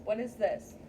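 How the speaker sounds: ordinary talk, nothing marked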